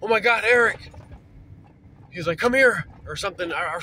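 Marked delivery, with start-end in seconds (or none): 0.0-0.9 a touch of retold panic, higher and louder; mild imitation of a scared man calling out; frantic. Brother scared by a cougar in the dark, mildly frantic. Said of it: "the franticness in his voice". 2.4-2.8 imitating a panicked call, higher and louder; a hint of fear, calling for help; frantic. Brother stalked by a cougar calls him over, mildly frantic. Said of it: "the franticness in his voice".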